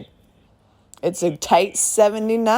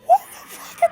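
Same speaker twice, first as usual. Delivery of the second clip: amazed outburst. Real, not acted. Gushing about a favourite movie.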